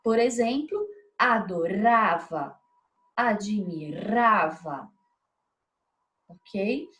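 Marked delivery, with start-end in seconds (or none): 1.2-4.9 adoRAva, admiRAva, slowly drawn out on RA; stress on penultimate syllable. Shown as a demonstration. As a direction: stretch the RA in adorava and admirava, very slow. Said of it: "Quando a penúltima sílaba é a tônica". (adorava, admirava devagar, alongando a sílaba RA)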